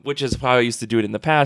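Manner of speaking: ordinary talk, nothing marked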